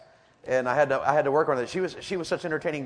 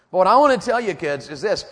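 usual speaking voice

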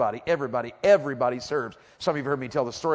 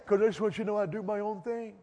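mock teen reply; 6 semitones higher, 5 dB quieter than usual. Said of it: "acted reply of Shay".

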